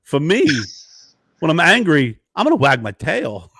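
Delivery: loud, halting two-word bursts; Christopher Walken impression. Walken correcting Jay on tail wagging, halting impression. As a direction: punch each phrase, odd pauses between. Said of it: "He goes".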